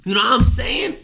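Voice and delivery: losing voice, strained and hoarse; rough sick throat. Real, not acted. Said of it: "I'm losing my voice".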